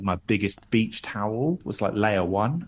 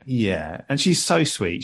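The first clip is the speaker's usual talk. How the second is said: normal talking voice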